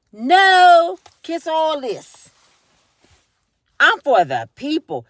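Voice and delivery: loud defiant refusal. Retold or imitated. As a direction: refuse her loudly. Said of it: "And she said".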